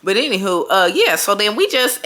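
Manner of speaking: ordinary talk, nothing marked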